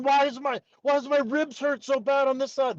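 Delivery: high-pitched retold panic; frantic, high mock distress; freaking out. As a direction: act out waking up in a panic, pitch way up. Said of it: "I'm freaking out".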